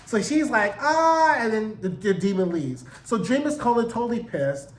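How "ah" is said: short high, louder "ah"; mock scream. Exorcist casting out the demon, high mock cry. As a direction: imitate a brief high-pitched cry.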